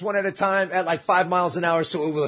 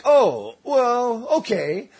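mock polite acceptance, broken into pieces; halting, put-on "fair enough"; retold backing down; 5 semitones higher, much slower than usual. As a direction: give in word by word, acting it out. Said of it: "I'm all like".

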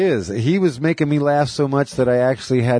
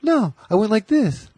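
high mock wife answering; lightly voiced wife, pitched up; wife quoted; 12 semitones higher than usual. Wife demonstrating to her husband in a high voice. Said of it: "She's like".

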